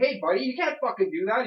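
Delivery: loud and high, telling the guy off hard; retold angry yell. Tenant retelling yelling at a man dumping trash, angry and loud. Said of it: "You heard how angry I get".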